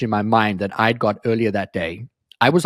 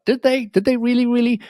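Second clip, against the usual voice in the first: skeptical disbelief; 10 semitones higher than usual. Retold or imitated. Doubting other people's claims.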